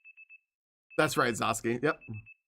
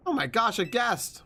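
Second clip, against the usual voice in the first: mock surprise; 7 semitones higher than usual. Mocking surprise at a visitor.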